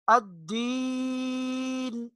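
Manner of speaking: الدین slowly, ی drawn long; الدین, stretch the yaa; slow chanted الدین. Shown as a demonstration. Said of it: "کھینچ کر تھوڑا سا لمبا کر کے پڑھیں گے".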